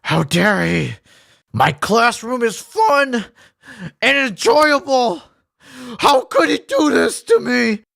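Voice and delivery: a hammed-up wounded teacher rising to a cry; mock teacher going from grumble to whine; imitating an offended teacher. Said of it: "They're like, teacher's death glare".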